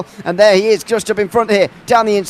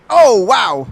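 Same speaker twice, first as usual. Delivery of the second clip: surprised; 4 semitones higher, 6 dB louder than usual. Real, not acted. Car flipped into the air.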